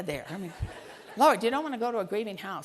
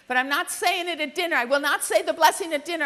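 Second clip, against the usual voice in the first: mock stubborn child, higher and louder; defiant refusal; 5 semitones higher, 5 dB louder than usual. Five-year-old refusing her mother the blessing, acted defiant. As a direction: dig in loudly like a defiant kid.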